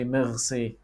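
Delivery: 'merci' with a throaty French R. Shown as a demonstration. Teacher illustrating the seventh mistake, French R. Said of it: "the French one".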